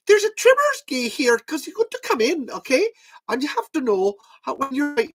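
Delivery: high-pitched, hammed-up Celtic brogue; loud, high comic brogue; put-on brogue. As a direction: pipe it out like an excitable brogue caricature. Breaks into put-on brogue skit.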